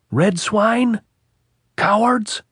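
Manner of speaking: high, loud jeering insults; officer taunting the enemy, shouting; cursing shout. A narrator's reading. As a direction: taunt the hill at the top of your voice. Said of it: "He cursed and vilified the hilltop".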